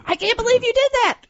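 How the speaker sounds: mock a parent blowing up at their kid; scolding parent. Shown as a demonstration. Parent reacting badly to a teen's confession, shrill fast scolding. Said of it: "as opposed to".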